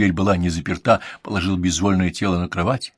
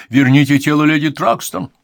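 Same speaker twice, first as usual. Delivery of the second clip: high, loud order; commanding; 6 semitones higher, 7 dB louder than usual. A narrator's reading. Man commands the writhing figure, loud order. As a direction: demand it with force. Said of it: "скомандовал он извивающейся фигуре".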